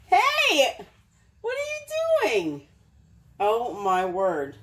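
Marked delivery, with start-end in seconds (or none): 0.1-2.6 much higher mock-scolding cry; high scolding squeal at the toast thief; caught him red-handed. Real, not acted. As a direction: squeal it at the dog, more amused than cross. Dog caught eating the toast.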